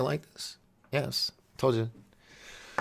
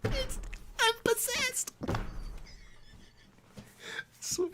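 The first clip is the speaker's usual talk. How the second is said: helpless laughter; 9 dB quieter than usual. Real, not acted. Host cracking up at the voice, helpless laughter. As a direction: wheeze it out mid laughing fit.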